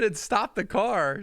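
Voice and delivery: high-pitched, slow incredulous echo; shocked squeak of disbelief; stunned disbelief. Retold or imitated. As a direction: repeat it back like you can't believe it. Retelling disbelief at wife's news.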